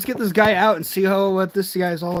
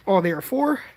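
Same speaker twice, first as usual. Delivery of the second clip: ordinary delivery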